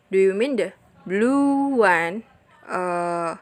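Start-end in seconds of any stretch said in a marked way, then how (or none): 0.1-2.2 "blue" drawn out long and raised; slow right down and stretch "blue"; long stress on blue. Shown as a demonstration. Teacher clarifying which one, long stress on "blue". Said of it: "diperjelas dengan penekanan".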